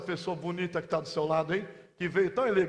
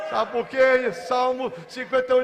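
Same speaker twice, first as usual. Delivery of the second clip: usual speaking voice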